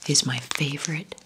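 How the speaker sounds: ordinary delivery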